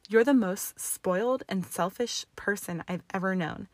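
usual speaking voice

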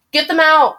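panicked yell to get them out. Acted. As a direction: yell it at the rescuers. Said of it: "I yell".

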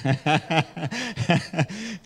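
amused chuckle in a run; real laugh. Real, not acted. Preacher joking to the church about the rain, laughing. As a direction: laugh at your own remark.

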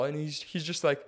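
normal talking voice

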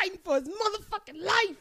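hammed-up, high-pitched outburst; emphatic exclamation. Cohost riffing on a struggling rapper, hammed-up exclamation. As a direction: punch it out with mock urgency.

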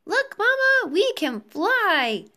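put-on high, excited little-boy voice, drawn out at the end; chirping child. A narrator's reading. Winged boy showing his mother he flies, high excited chirp. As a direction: squeal it with delight. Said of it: "chirped Philip".

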